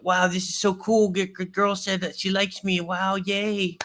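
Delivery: high, gushing imitation of a newbie; mock giddy, over-eager high voice; puppy dog excitement. Coach imitating a guy new to game, high puppy dog excitement. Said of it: "really excited and enthusiastic".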